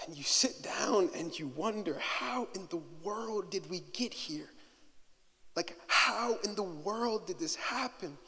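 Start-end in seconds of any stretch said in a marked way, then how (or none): 2.0-8.2 soft, much quieter "How in the world did we get here?"; hushed despair. Acted. Quoting a despairing prayer, hushed.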